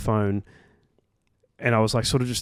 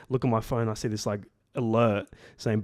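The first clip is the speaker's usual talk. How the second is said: ordinary talk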